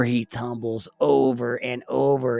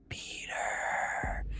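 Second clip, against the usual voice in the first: a faint whisper through the wall; ghostly whisper; 8 dB quieter than usual. A narrator's reading. Ghost girl whispering to Peter at night, faint whisper. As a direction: breathe his name softly from the wall.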